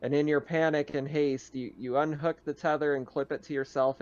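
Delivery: ordinary delivery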